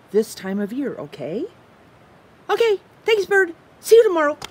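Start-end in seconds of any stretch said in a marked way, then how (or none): 2.5-4.4 high, fast, frantic little squirrel voice; excitable squirrel. Acted. Squirrel frets over his missing leaf, high and anxious. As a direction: play the squirrel, jumpy and loud.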